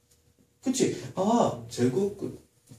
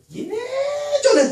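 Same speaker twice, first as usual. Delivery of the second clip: 예전에 with a long, held first syllable; drawn-out emphasis; 6 dB louder, much slower than usual. Real, not acted. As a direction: stretch the start of 예전에 out loud and long. Teacher stresses an earlier lesson. (예전에 첫소리를 길게 끌며 강조)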